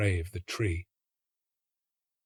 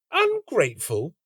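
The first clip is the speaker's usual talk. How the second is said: higher, indignant rising echo; offended girl; 6 semitones higher than usual. A narrator's reading. The girl repeating his insult, offended high question. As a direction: throw the word back at him. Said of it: "said the girl".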